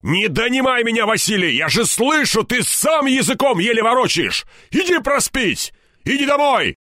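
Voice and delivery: high, strained angry shouting; furious high-pitched tirade; yelling into phone. A narrator's reading. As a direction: bawl out a drunk colleague over the phone. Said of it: "орал в трубку так, что Румянцев поморщился".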